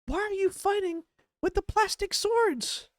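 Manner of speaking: very high falsetto parody of a parent; mock pleading parent. Reactor parodies a parent scolding sword-fighting kids, mocking falsetto.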